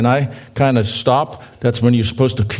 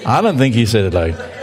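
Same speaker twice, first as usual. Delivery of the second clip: ordinary talk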